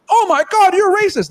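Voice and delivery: high-pitched mimicked indignation; mock outrage. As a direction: squeal it like a scandalized accuser, hammed-up. Mimics outraged reaction to criticism.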